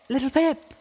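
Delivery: very high, loud desperate scream. A narrator's reading. Velvet Remedy screams for Littlepip, very high and loud. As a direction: scream her name in panic. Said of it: "Velvet Remedy screamed".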